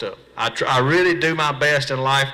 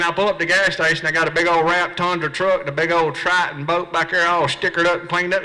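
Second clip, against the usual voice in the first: normal talking voice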